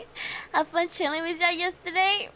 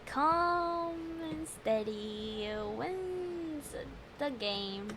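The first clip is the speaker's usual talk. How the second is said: slow calming mantra; 10 dB quieter, much slower than usual. Real, not acted. Player calming down before retrying, slow hushed mantra. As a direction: breathe the words out slowly and softly.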